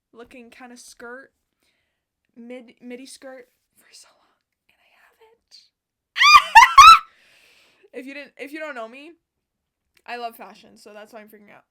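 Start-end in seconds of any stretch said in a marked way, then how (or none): 3.8-5.6 hushed unvoiced reveal; whisper. Real, not acted. Vlogger revealing her dream skirt in a whisper. 6.1-7.9 high, loud delighted shriek of laughter. Real, not acted. Vlogger shrieking with delighted laughter.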